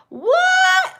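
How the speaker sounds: sudden high squeak of disbelief; startled shriek; surprised what. Real, not acted. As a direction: shoot the word way up in shock. Surprised reaction to chat.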